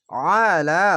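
ordinary talk, nothing marked

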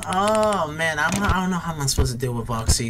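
ordinary delivery

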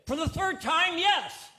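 loud, high, fed-up snap at her husband; exasperated wife. Retold or imitated.

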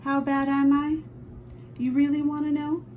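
ordinary delivery, nothing marked

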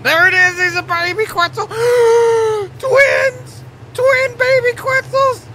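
overjoyed high-pitched squeals at hatching twins; ecstatic shrieking. Real, not acted. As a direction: scream with delight at the newborn twins. Baby Quetzals hatch, twins.

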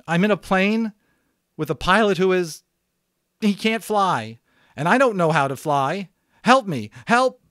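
desperate pleading. Acted. Boy telling the radio he can't fly, desperate. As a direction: beg for help, near panic.

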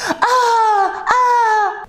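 high mock cries of pain; imitated scalded yelp. Shown as a demonstration. Teacher replays burning her mouth, high mock pained yelps. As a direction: yelp as if your mouth burns. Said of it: "Ağzım yanınca ne dedim?".

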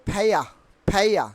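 "ফেয়া" with aspirated p, no final r; corrected pronunciation. Shown as a demonstration. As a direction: puff the p and drop the r in "ফেয়া". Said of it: "কি উচ্চারণ হবে?". ("ফেয়া" মহাপ্রাণ ফ, র ছাড়া)

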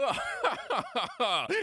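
gloating villain laugh; triumphant rising cackle; evil laugh. Acted. Villain laughs at his plan.

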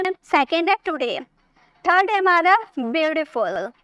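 ordinary talk

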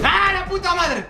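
high, loud panicked Spanish curse; frightened curse. Real, not acted. Startled gamer swears in Spanish: high panicked curse. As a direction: blurt it out in fright. Scared cursing after scare.

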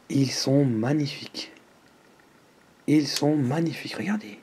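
soft, reverent whisper; hushed awe. Real, not acted. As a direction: breathe it in quiet wonder. Awed on revealing the macarons.

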